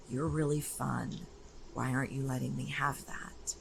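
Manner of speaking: soft, hushed, near-whispered aside; gentle coaxing. Retold or imitated. Coach drawing out a client's hidden sounds, hushed coaxing. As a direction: drop the volume and coax it out of them.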